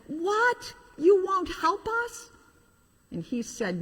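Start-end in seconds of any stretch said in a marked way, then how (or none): 0.1-2.3 high disbelieving cry, much higher; shocked toys. Acted. Toys stunned by the engine's refusal, high shocked cry.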